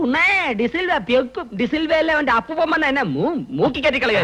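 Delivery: high-pitched, worked-up boasting; scoffing bluster. Acted. Man scoffing at his partner's warning about D'Silva, blustering. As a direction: dismiss the threat in a high, agitated voice.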